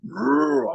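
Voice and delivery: high mock creature growl. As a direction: growl like a beast in the woods. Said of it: "I kept hearing this".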